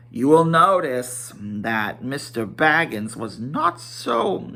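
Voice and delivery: plain reading voice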